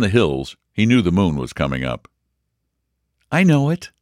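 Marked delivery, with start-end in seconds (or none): 3.3-3.9 bright, higher happy reply; a girl pleased she knows; said happily. A narrator's reading. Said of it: "Marjorie said happily".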